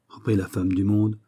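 ordinary delivery

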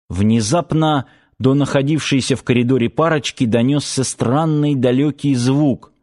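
ordinary delivery, nothing marked

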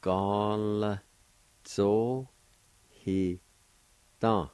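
each syllable said apart with a pause. Shown as a demonstration. Teacher sounding out the first part, syllable by syllable. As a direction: break it into separate syllables. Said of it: "like this".

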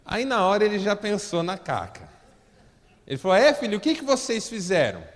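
high, loud preaching voice; loud preacher telling the story; fervent preaching. Acted. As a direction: drive the story home from the pulpit. Preaching at full force.

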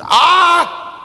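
loud high amazed cry. Acted. Nun astonished, loud high cry. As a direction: yelp in astonishment.

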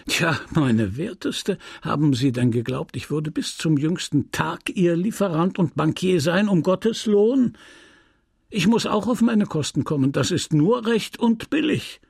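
high-swinging, mock-polite reproach; patronising, rising into self-righteous complaint; condescending merchant. A narrator's reading. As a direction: lecture a debtor with scornful indignation. Merchant scolds his debtor.

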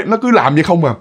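ordinary delivery, nothing marked

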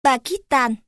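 Pakistan said the Northern way, ending in "-tan"; Northern Vietnamese accent. Shown as a demonstration. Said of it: "Bắc".

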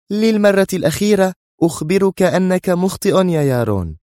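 high-pitched final warning; angry, stern. A narrator's reading. Sonia warns Yaron one last time, angry and stern. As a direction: insist high, at the end of patience. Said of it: "رمقته بنظرة غاضبة صارمة".

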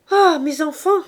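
high, loud exclamation; excited greeting. Acted. Virginie bursts in, loudly greeting the laundresses. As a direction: burst in from the cold. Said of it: "dit-elle".

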